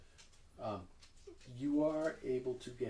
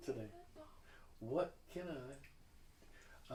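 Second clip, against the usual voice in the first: put-on hostess in a lightly southern accent; 12 dB quieter than usual. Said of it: "the real southern accent".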